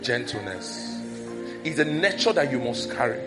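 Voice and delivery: ordinary talk, nothing marked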